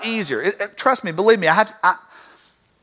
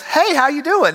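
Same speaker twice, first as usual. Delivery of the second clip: cheery greeting; 7 semitones higher than usual. Retold or imitated. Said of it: "Brer Rabbit looks at him and says".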